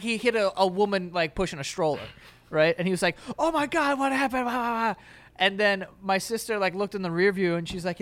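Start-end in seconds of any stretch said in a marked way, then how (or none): 3.4-5.0 high, wailing mock panic; hammed-up hysterical dad; imitated freak-out. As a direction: panic dissolving into a mock wail. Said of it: "he started freaking out".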